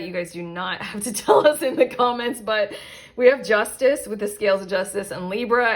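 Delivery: normal talking voice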